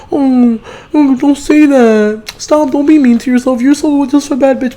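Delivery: high, slow mock-sweet pity voice; mocking fake sympathizer. Mocking a fake-caring friend who pities her. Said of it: "Don't let nobody else be like".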